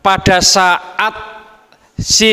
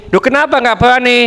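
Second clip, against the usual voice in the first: higher, fast puzzled question; surprised husband; 8 semitones higher, much faster than usual. Acted. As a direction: fire the question back in disbelief. (suami bertanya cepat dengan nada heran)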